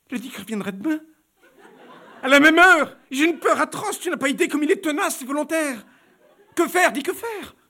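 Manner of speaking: high, loud, nervous laughter; frightened giggle. Acted. Baroness telling her friend he will return, high and nervous. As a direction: pour out the fear between laughs.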